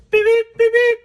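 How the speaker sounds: high, loud mock horn beeps; playful high-pitched toot-toot; imitated horn honk. Imitates honking at a babe.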